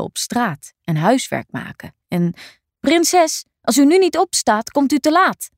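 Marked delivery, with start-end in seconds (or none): 2.8-5.5 high, loud urgent wake-up call; urgent calling servant. Acted. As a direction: call her out of bed, pressing. Servant urgently waking princess. (dringend roepende lakei)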